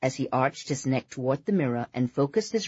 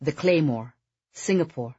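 usual speaking voice

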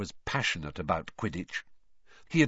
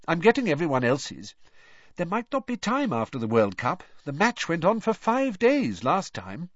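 bossy mother voice; 7 semitones higher, 4 dB louder than usual. Acted. Mother sending kids to bed.

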